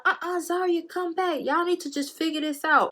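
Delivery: high-pitched urging, imitating a friend; imitated calling back. Storyteller imitating friends calling her back, high and insistent.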